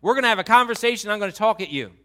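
loud, high, confrontational tirade; God as tough dad. Acted. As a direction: lay down the challenge at full volume. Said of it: "I hear God saying".

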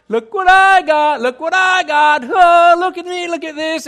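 loud, gushing show-off of the new ring, half sung; mock newly engaged woman showing off, gushing. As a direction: parade the ring, thrilled and a little sing-song. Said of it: "You walk with your hands straight out".

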